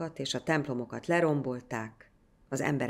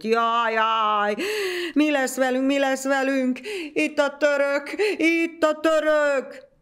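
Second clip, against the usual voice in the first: much higher, loud screeching crazy woman; shrieking madwoman; 12 semitones higher, 8 dB louder, slower than usual. A narrator's reading. Mad Tera running between houses, shrieking in panic. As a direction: screech in panic through the village. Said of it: "rikácsolva futkosott".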